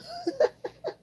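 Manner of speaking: quick high-pitched giggle; a little laugh at herself. Real, not acted. Laughs at own joke.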